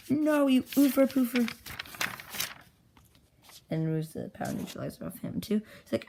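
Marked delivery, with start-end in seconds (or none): 0.1-1.5 higher, louder, slow cartoon scolding; mock angry refusal. Character turns down another who wants to join, mock outrage. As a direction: stretch out a hammed-up indignant no.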